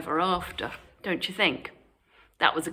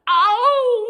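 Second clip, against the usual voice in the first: high, loud pained howl; wolf howling with a slammed nose; 9 dB louder than usual. Acted. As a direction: yelp as the door hits. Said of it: "howled the wolf".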